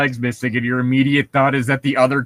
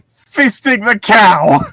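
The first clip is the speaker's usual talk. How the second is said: higher, louder, slow disbelief; incredulous yell; 8 semitones higher, 7 dB louder, slower than usual. Real, not acted. Friend repeats the absurd line, shouting in disbelief.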